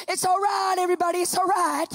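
calm the whole plane; calling reassurance. Retold or imitated. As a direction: nurse calling out to the passengers. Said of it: "She goes".

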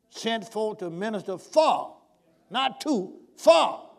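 slow, emphatic "for", leaping up; stress on for. Real, not acted. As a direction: hit "for" hard, set against "to". Contrasting two prepositions in the verse.

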